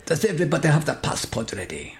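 put-on lightly Italian-sounding Spanish accent; Italian-ish accent. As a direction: try it with an Italian lilt. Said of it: "think Italian".

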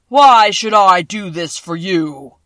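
a vast, slow, thundering wizard; voice of the great Oz. A narrator's reading. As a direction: boom each word out like a huge magic head.

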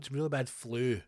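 usual speaking voice

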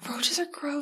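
breathy unvoiced aside; whisper. Real, not acted. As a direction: whisper it, disgusted.

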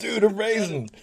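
put-on high, surfer-dude American accent. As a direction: play up a laid-back American dude, pitched high. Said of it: "That's my American accent there".

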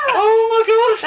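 high, squealing through real laughter; helpless laughter. Real, not acted. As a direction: gasp it out mid laughing fit.

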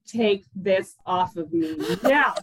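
slow, deliberate command; retold firm order. Labouring wife telling husband to remove the sombrero, firmly. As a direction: spell out the order, word by slow word.

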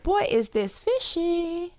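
high, slow joke delivery; milk the pun; punchline. Acted.

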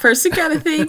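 normal talking voice

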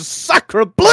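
high, loud French "Sacre bleu"; French kid exclamation. Retold or imitated. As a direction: burst out like a startled French boy. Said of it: "takes his snorkel out of his mouth and goes".

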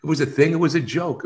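pleading innocence, pitch up; defensive protest. Retold or imitated. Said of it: "I says".

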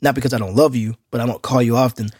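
usual speaking voice

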